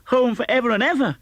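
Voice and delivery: put-on high, bright-eyed child voice, eager and joyful; a small girl bursting with happy news; child's voice lifted well above the reader's own; little Fan.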